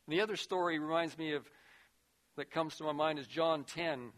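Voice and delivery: ordinary talk, nothing marked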